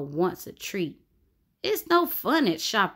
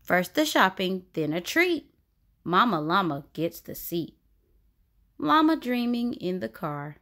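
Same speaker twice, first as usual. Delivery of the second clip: usual speaking voice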